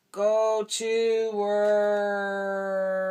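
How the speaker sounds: very slow, drawn-out alien chant. A narrator's reading. Dad reading, the aliens answer Woody in unison. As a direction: stretch every word like a robot alien.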